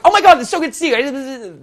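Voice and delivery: high, loud over-the-top greeting; hammed-up gushing hello; overly excited. Shown as a demonstration. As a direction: overdo the excitement, pitch shooting up. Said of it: "overly excited".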